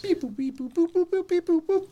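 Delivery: very high, chirpy beep boop keypad noises; imitated phone beeps. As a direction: sing out the button presses like a phone.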